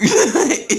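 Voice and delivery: high-pitched wheezing laugh; shrill laughing burst. Real, not acted. Laughing at his own story.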